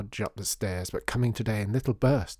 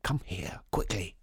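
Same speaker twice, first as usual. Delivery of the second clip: hushed urging; 2 semitones lower, 4 dB quieter than usual. A narrator's reading. Father summoning his daughter unheard, hushed. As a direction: keep it down, half-whispered. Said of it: "trying not to be too loud".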